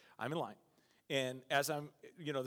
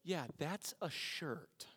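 dry sarcasm; 5 dB quieter, much slower than usual. Retold or imitated. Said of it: "She's like".

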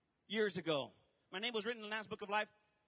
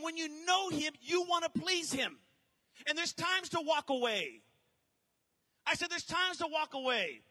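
rising, loud sermon build; building from firm to shouting; fiery preaching; 5 semitones higher, 5 dB louder than usual. Acted. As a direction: preach it to the crowd with fire. Preaching at force.

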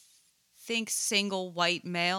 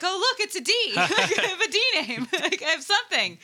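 high, louder laughing exclamation; mock amazed discovery; 10 semitones higher, 4 dB louder, faster than usual. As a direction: act out a delighted find through laughter. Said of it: "he was like".

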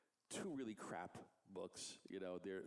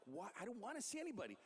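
mock phone yell; 11 semitones higher than usual. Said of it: "Jim's just yelling at him on the phone".

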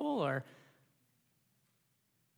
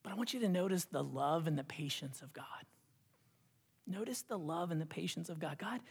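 soft whispered emphasis; hushed; 2 semitones lower, 9 dB quieter, faster than usual. Real, not acted. Preacher whispering to the congregation about God's patience. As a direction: draw them in with a whisper.

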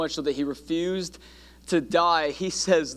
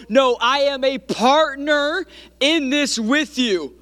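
higher-pitched, building preacher's declaration; impassioned preaching; 7 semitones higher, 4 dB louder than usual. Acted. Preacher voices John declaring partnership to the congregation, impassioned and building. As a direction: preach it with mounting force, stretching the final line.

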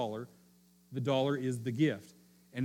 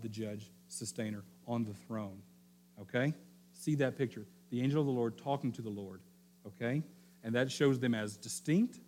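ordinary talk

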